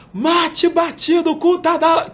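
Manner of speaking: high, loud vendor cry; beach vendor call. Shown as a demonstration. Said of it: "Se eu tacar o meu anúncio".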